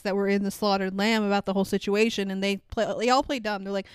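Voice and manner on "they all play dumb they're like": ordinary talk, nothing marked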